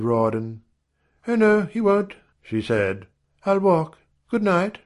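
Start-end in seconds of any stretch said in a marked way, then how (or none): 1.3-2.1 high, firm female character; high-pitched contradiction; woman refusing. A narrator's reading. Janet refuses the offered escort. 3.5-4.8 high-pitched female character; high, decisive goodbye; woman taking leave. A narrator's reading. Janet refuses and leaves.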